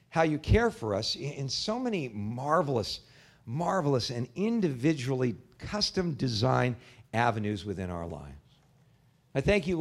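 pastor leading his congregation in prayer; closing prayer. Real, not acted.

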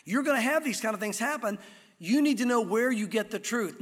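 normal talking voice

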